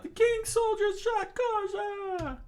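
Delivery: high, slow anguished cry; screaming rebels. Acted. Rebels crying out that their leader was shot. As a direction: wail out the news. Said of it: "screaming out".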